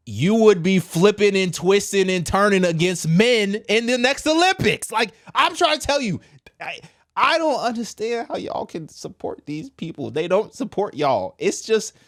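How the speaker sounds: pushing hard, almost yelling the point; fired-up rant. Real, not acted. Ranting to viewers about the election, pitched high and loud.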